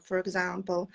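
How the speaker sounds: normal talking voice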